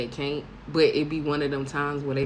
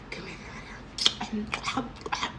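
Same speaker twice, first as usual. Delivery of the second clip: whispered come here; 5 semitones higher, 6 dB quieter, much slower than usual. Retold or imitated. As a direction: whisper it, pulling him close. Drunk self inviting guy.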